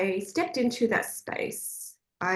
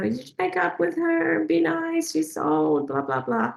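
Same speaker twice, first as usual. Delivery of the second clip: mock nagging; 8 semitones higher than usual. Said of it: "she's just nagging".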